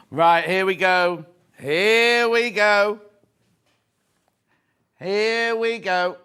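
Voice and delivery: loud, long drawn-out announcement; big slow build-up to a reveal; excited anticipation. Real, not acted. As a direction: stretch each word out slowly and loudly.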